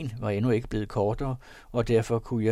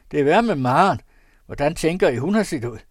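much louder, higher scolding; loud reproach; 5 semitones higher, 9 dB louder, faster than usual. A narrator's reading. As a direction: shout the bad news at him. Lamstrup shouting about Maren. (høj, bebrejdende stemme)

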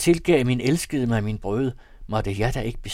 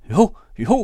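sudden high, loud cry of yes; 8 semitones higher, 11 dB louder than usual. A narrator's reading. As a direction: burst out, much higher and louder. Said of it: "råbte hun". (råbt højt og lyst)

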